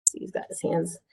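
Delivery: usual speaking voice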